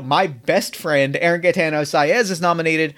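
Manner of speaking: ordinary talk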